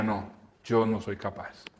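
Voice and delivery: soft defeated murmur; quiet defeat. Retold or imitated. Retelling the nervous singer's words, soft and defeated. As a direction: let the words sink, giving up.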